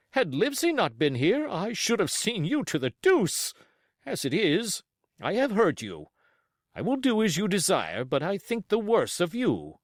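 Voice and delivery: offended gentleman; indignant squire. Acted. Squire scolds captain.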